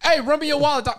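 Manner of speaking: high tough-guy mugging voice; mugger voice. Retold or imitated. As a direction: demand the wallet like a street robber. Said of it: "You start running me all crazy".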